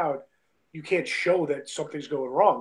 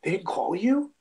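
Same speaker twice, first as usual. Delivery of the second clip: higher-pitched, puzzled disbelief; mimicking someone taken aback; imitated confusion; 6 semitones higher, slower than usual. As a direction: ask as if baffled by the news. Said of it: "with such confusion".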